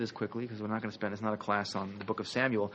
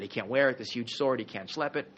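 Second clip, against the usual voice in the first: ordinary talk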